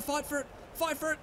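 normal talking voice

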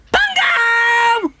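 high, loud yell of his name; loud shout. Acted. Wellington shouting for lost Bungo, full loud yell. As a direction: bellow it as loud as you can. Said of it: "I shouted loud then".

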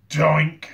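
cartoon doink. A narrator's reading. Two heads clonking together, loud doink. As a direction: hit it like a cartoon bonk.

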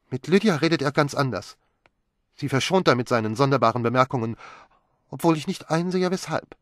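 peeved and quick; Magdalene resentful. A narrator's reading. Wife resents Lydia's special treatment, quick and high.

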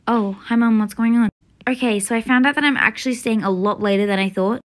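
ordinary talk

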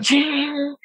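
high, rising "ching"; imitated chain ring. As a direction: mimic a stretched chain vibrating. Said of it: "the vibrating part on the chain".

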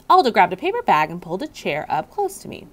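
ordinary delivery

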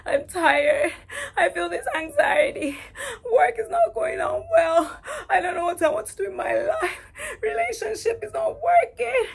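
sobbing, high and loud; crying out to God. Retold or imitated. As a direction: cry it out, voice breaking and rising to a wail. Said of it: "I started to cry".

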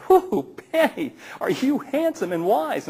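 lightly voiced admirers cooing at Benny; girl frogs. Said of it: "all the little girl frogs were going".

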